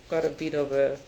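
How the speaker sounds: ordinary delivery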